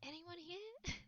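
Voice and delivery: faint little voice, lifted and soft. Acted. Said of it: "maybe a little quieter".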